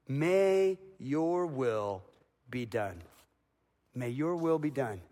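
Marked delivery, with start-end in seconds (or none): 0.1-3.0 slow prayerful. Shown as a demonstration. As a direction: stretch it out like a whispered surrender. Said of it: "he's praying the cup would pass".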